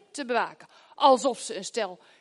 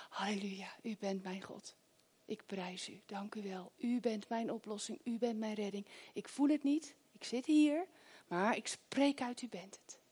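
much softer, hushed worship; soft prayer; 10 dB quieter than usual. Pretend. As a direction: pray under your breath to God. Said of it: "mijn hart zingt naar u en zegt".

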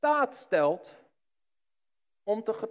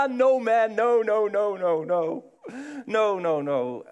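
louder, hurried string of no's; panicked denial; 3 semitones higher, 5 dB louder, slower than usual. Retold or imitated. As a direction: wave it off in a panic. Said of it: "weet je wat hij zei? Gewoon eerste reactie".

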